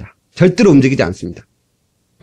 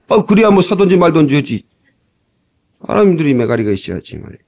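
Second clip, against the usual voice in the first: ordinary talk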